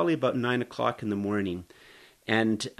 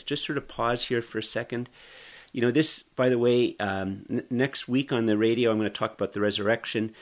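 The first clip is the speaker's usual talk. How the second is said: ordinary delivery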